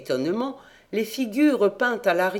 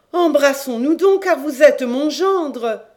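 joyful queen; 9 semitones higher, 8 dB louder than usual. A narrator's reading. Queen welcoming her son-in-law, joyful and loud. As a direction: burst out with joy, stretching the words.

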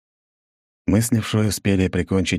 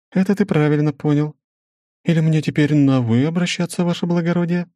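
measured, higher female voice; woman's voice; 10 semitones higher, 3 dB louder than usual. Acted. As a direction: narrator voicing a businesswoman, higher. Svetlana teasing.

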